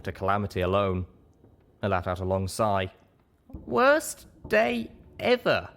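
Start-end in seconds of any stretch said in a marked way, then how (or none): 3.6-5.7 put-on much higher, slow, young pony voice; high, slow, self-pitying whine; weary groan. A narrator's reading. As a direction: drag it out, fed up and sorry for herself. Young narrator's weary inner voice.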